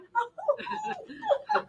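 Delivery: very high, light laugh. Real, not acted. Giggling while recalling her hysterical crying. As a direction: let a giggle rise up.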